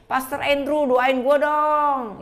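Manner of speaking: loud, high, drawn-out plea; mock pleading. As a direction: whine for prayer like a needy church member, stretching the end. Imitates member always wanting prayer.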